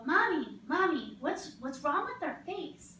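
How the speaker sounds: put-on high child's voice tugging at mommy; child calling mom. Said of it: "Or you hear them go".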